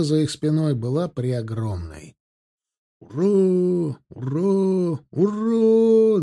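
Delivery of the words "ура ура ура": high, drawn-out hurrah; long ringing battle cheer; distant cheering. A narrator's reading. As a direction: cheer like a regiment down the line. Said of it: "донеслось от ахтырцев".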